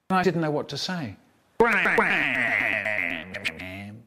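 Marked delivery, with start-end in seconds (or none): 1.6-4.0 slow, drawn-out mock snarl. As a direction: stretch the snarl and let it sink low. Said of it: "and Sheddle snarled".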